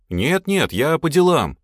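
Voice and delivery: high, loud hurried excuse; flustered. A narrator's reading. Lad escapes when called over, flustered. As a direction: excuse yourself in a flap. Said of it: "засуетился парнишка".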